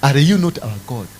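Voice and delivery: usual speaking voice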